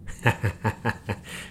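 a short airy chuckle; quiet scornful laugh; breathy laugh. Real, not acted. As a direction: laugh under the breath at them. Laughs after insult.

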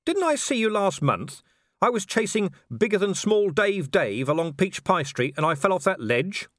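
high, insistent questioning; agitated Vimes. Acted. Vimes reminds Death of a past fall, high insistent voice. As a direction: pitch it up and press the point.

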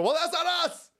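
high mock-panicked denial; hammed-up Israel spokesman insisting innocence. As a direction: squeal it like a caught liar. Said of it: "and they go".

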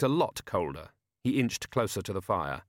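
usual speaking voice

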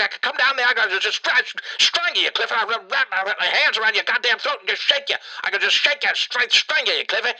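high-pitched comic rage, building to a peak; hammed-up strangling-mad tirade, pitch climbing; furious rant. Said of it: "Because I'm so fucking angry".

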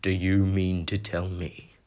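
a giant growling at the uncle; growling Hagrid. Acted. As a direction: hold the fury barely in check. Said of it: "growled Hagrid".

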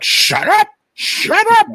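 very high shrieking yell. Retold or imitated. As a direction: shriek at him to stop. Retold reaction to scare.

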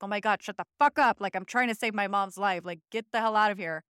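loud, fast furious shout; angry yell. Retold or imitated. Daughter yelling at her abusive stepdad to get out, furious shout. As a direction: snap back hard, fed up and desperate. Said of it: "And I was just like".

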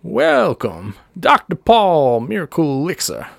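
high, drawn-out showman's welcome; stage voice. Acted. Doctor Paul practising his welcome before the show, showman's stage voice. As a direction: warm up a huckster's stage voice. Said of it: "let me get my stage voice on".

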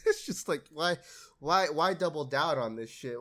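usual speaking voice

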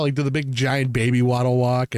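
ordinary delivery

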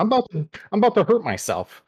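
panicked high voice before the ghost pepper chip; nervous squeak. Real, not acted. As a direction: squeak out the dread. Bracing before eating hot chip.

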